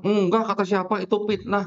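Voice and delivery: a hint of a huffy Sundanese local; indignant Sundanese cameraman. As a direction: play the offended Sundanese friend waving it off. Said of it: "Dia bilang".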